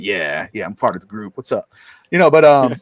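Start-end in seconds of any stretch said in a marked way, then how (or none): 0.0-1.7 mock cool. Dad plays one of the group.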